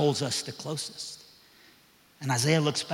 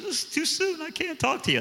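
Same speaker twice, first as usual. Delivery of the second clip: put-on much higher, upset girl voice; tearful girl; 12 semitones higher than usual. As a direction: voice the crying teen brushing him off, pitched way up. Girl crying under tree.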